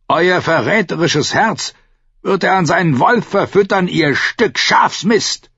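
high, loud, furious abuse; shouted insult. A narrator's reading. Captive Benfred insults his captor, shouting. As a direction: hurl the insult at full voice. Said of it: "schrie Benfred Tolhard".

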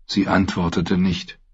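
ordinary delivery, nothing marked

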